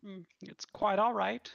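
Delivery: sweet goodnight from a waitress. Acted.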